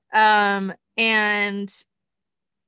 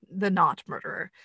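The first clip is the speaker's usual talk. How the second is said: ordinary delivery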